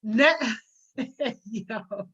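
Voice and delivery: ordinary talk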